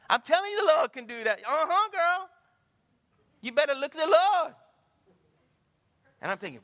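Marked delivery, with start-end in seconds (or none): 0.1-4.5 high and loud, testifying to a stranger; high, loud church lady praise; fired-up church lady. Retold or imitated. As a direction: hype it up like a woman on fire for Jesus.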